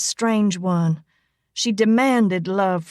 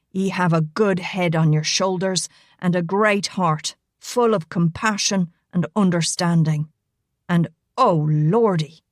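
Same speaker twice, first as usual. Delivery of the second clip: Irish brogue. Acted. Rigo smoothing over his tease to Elena, roguish Irish brogue. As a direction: flatter her fast, then savour the cheek.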